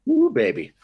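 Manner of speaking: a gleeful whoop at a juicy question; delighted ooh. Real, not acted. Dentist thrilled by the question, gleeful loud squeal.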